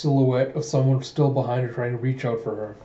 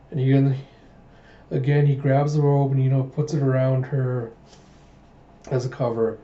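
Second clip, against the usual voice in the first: ordinary delivery, nothing marked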